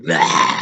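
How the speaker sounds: loud, low, rough wrestler roar; Heat Wave roar. Retold or imitated. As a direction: roar like a beefy pro wrestler. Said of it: "he's like Bill Goldberg from the W W E".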